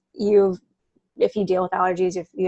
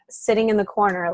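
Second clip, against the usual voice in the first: ordinary talk, nothing marked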